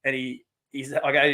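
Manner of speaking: ordinary talk, nothing marked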